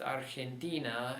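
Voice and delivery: Argentina stressed on the next-to-last syllable, ar-hen-TI-na; correct Spanish stress. Shown as a demonstration. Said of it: "That's why it's pronounced".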